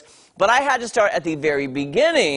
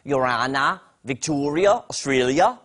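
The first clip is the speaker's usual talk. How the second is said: mock Australian accent, each name rising at the end; Australian accent impersonation; 3 semitones lower than usual. Shown as a demonstration. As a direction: put on an amateur Australian accent, lifting each word's ending. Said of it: "That's my impersonation of an Australian accent".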